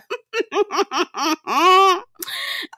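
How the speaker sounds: long high-pitched laugh. Real, not acted. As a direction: crack up, high and drawn out. Laughs at the retold joke.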